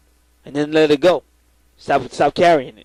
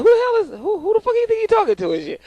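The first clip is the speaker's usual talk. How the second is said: mock angry retort; 3 dB quieter than usual. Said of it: "And I was like".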